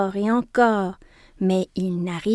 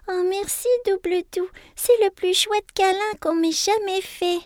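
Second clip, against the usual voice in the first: high, breathy sigh of thanks; 11 semitones higher than usual. A narrator's reading. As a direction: breathe it out, contented. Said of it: "soupire-t-elle".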